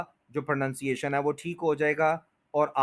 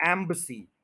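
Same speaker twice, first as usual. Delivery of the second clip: Embassy as "EM-buh-see"; stress on first syllable; 3 dB quieter than usual. Shown as a demonstration. Teacher introduces the next word, first-syllable stress. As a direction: lean on EM, shrink the middle of embassy. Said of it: "बिल्कुल इसी stress पे".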